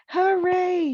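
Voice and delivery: high, loud joyful cheer; cheering hooray. Acted. Amelia Bedelia, seeing herself as the star, cheers high and loud. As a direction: burst out in delight.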